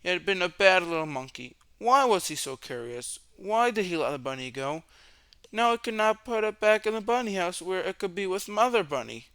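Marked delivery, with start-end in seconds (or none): none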